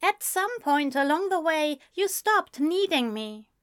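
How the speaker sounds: high, wistful character voice; sad, gentle reproach; resigned, hurt friend. A narrator's reading.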